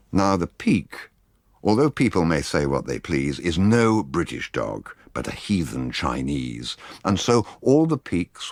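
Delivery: plain reading voice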